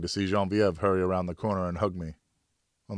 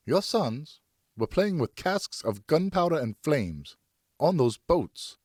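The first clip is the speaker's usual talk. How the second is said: higher, slow, building to louder bursts; building shout; 8 semitones higher, slower than usual. Acted. Wife scolding her husband about the boys, building to a shout. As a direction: start slow and rise into a shout. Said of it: "she shouted in progressively louder bursts".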